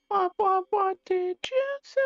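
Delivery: very high squeaky question. Pretend. Playful repeating back and forth.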